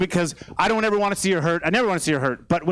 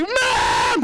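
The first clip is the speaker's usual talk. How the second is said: put-on high-pitched toddler tantrum shriek; meltdown scream. Dad imitating his daughter's curbside meltdown, piercing scream. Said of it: "five-alarm meltdown".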